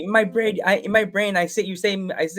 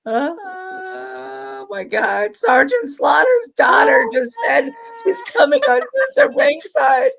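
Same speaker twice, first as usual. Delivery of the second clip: much higher cartoon voice, half shouting and crying; Tina freaking out; 14 semitones higher, 5 dB louder, slower than usual. Host voicing Tina, shrieking excitedly about his podcast guest. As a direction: squeal the news like an overexcited Tina. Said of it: "Tina going".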